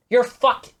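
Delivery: usual speaking voice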